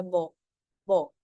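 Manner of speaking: बो with a B-like onset, low pitch; denasalized demo. Shown as a demonstration. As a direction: model बो as a lone low syllable. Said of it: "बो सुनाई देता है".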